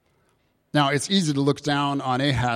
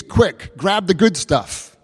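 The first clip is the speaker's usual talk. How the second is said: louder, higher, and slower on grab the good stuff; urgent; 6 semitones higher, 5 dB louder, slower than usual. Acted. Preacher acting out the name's meaning for the congregation, urgent and loud. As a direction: punch out grab the good stuff like a command to hurry.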